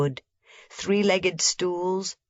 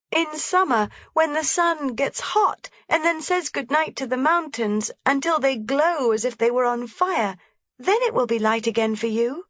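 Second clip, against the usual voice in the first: put-on lively little girl voice pitched high; a small girl pointing things out eagerly; young Heidi; 7 semitones higher than usual. A narrator's reading. Heidi telling grandmother about the shutter, bright and eager.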